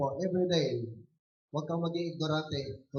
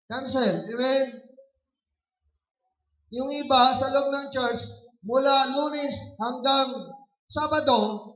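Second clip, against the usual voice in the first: raised, louder preacher's voice; forceful preaching; 6 semitones higher, 5 dB louder than usual. Real, not acted. Preacher rebuking laziness among churchgoers, forceful preaching. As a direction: press the point on the congregation in a lifted voice.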